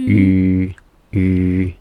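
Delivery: ü said as E with pursed lips. Shown as a demonstration. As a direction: say E, then round the lips to ü. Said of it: "you pucker your lips like a fish".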